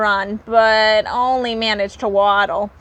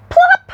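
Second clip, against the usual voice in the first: very high, louder pop sound; plop; 8 dB louder than usual. A narrator's reading. Narrator to listeners, a comic plop. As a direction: pop the word.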